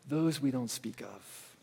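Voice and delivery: low, hushed and eerie; dark hushed horror-movie voice; spooky hush. Pretend. Spooky movie-village phrase.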